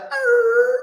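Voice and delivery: high yelp of pain; dog yelp. Retold or imitated. Shot dog crying out, high pained yelp. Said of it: "The dog said".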